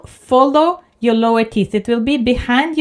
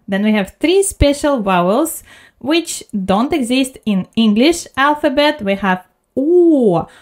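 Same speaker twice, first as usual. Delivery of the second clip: ordinary delivery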